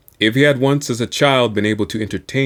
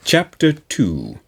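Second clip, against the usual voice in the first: ordinary delivery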